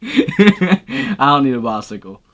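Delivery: slow, amused brush-off through a laugh; laughing dismissal. Real, not acted. Defending never learning to ride, laughing. Laughs off not riding bikes.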